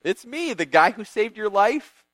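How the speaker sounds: cashing in on a favor; lightly hammed-up sucking up; fawning favor-seeker. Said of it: "going like".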